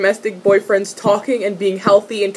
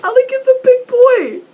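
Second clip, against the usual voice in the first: excited gush; 14 semitones higher, 4 dB louder than usual. Real, not acted. Gushing about Alec's size.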